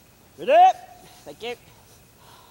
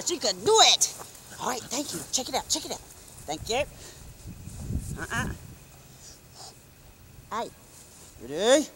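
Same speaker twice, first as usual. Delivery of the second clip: usual speaking voice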